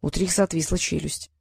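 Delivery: usual speaking voice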